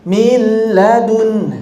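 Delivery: "mil ladun" with the "mi" stretched too long; mock the stretched vowel; drawn-out faulty demo; overlong vowel mistake. Shown as a demonstration. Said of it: "memanjangkan suara huruf".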